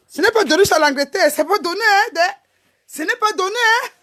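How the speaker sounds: high, loud defiant insistence; defiant protest. Real, not acted. To insulting viewers, defiant high protest. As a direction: push back hard, voice up high.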